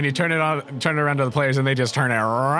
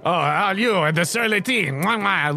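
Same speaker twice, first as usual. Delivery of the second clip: between kisses; 4 semitones higher than usual. Acted. Chef greets fancy guest.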